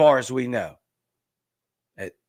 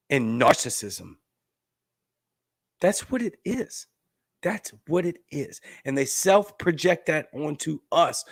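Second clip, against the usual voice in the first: ordinary talk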